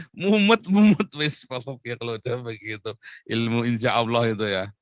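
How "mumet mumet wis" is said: exasperated. Acted. Exasperated at excuse-makers.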